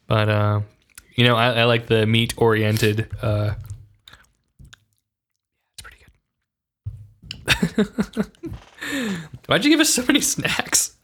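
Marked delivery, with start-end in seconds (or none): 5.7-6.1 barely audible whisper. Real, not acted. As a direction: whisper it aside. Whispered aside.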